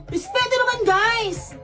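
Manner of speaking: high-pitched plea, little real volume; exasperated yell. Acted. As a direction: cry out to an unruly class. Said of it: "pasigaw tuloy ako ng".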